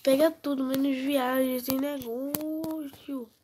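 high, loud frustrated gamer; frustrated excitement. Real, not acted. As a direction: yell at the broken game. Frustrated with the game. (frustrado e agitado, em voz alta)

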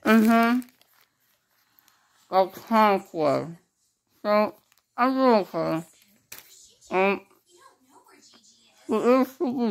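muffled through mouth packing; talking with gauze in mouth. Real, not acted. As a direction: lips held around gauze.

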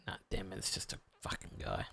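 muttered whisper, disappointed; a whispered curse at a false find; whispered letdown. Real, not acted. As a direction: drop to a whisper, deflated. Disappointed aside while searching.

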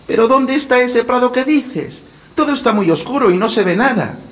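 put-on high, worried small girl; little girl voice. A narrator's reading. Gretel worried in dark.

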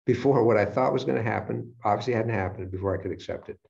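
usual speaking voice

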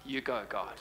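ordinary talk

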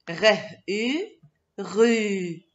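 "Re-u" in two parts, then "rue" as one; teacher blending "Re-u" into "rue"; sounding out. Shown as a demonstration. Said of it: "c'est le tour de la syllabe".

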